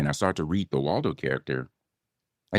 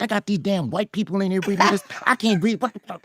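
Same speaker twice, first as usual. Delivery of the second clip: much higher, incredulous inner rant; inner panic; 12 semitones higher than usual. Retold or imitated. Actor among rival readers in the hallway, panicking in his head. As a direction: replay a private freak-out in a high voice. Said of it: "This is what's going on in my head".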